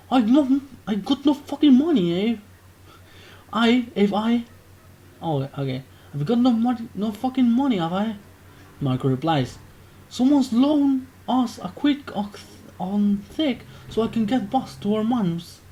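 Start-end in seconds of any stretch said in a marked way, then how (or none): 0.1-8.2 high, loud flustered geezer; a mock-frantic Brit patting empty pockets; panicked British lad. Marco protests to Jack, loud high British lad. 10.1-15.6 exaggerated English accent. Marco explains the borrowed quid, hammed-up English accent. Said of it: "I'm reading like this".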